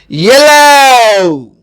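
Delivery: yellow, stretched out slowly with a clear ye sound; slow model word. Shown as a demonstration. Teacher modelling یلو slowly for the class. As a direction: say یلو slowly, syllable by syllable, for learners. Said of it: "آپ یو بھی بول سکتے ہیں".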